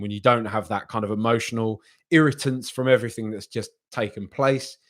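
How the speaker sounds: normal talking voice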